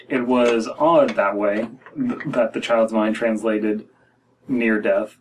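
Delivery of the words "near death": ordinary talk, nothing marked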